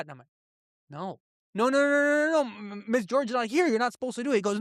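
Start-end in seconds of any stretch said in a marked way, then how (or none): 0.9-4.4 high, rising panicked no no no; a kid begging to get out of it; mock panic, much higher; panicked child protest.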